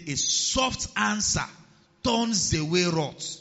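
very slow, deliberate verse; slow scripture quote. Shown as a demonstration. Preacher reading Proverbs slowly to the congregation. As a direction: lay out each word of the verse. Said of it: "scripture read slowly".

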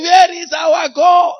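high, loud, drawn-out mock cry of fear; grito imitado. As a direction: wail it out like the frightened girl. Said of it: "holding her hand, shouting".

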